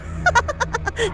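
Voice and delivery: high crazed cackle; villain laughing over bodies, maniacal cackle. A narrator's reading. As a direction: cackle like a madwoman. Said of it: "She started laughing maniacally".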